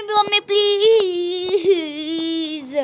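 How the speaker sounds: long drawn-out crying "mummy, please"; wailing plea. Acted. Child begging mother to keep the doll, crying wail. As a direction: stretch it into a sobbing wail.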